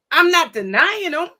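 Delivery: ordinary talk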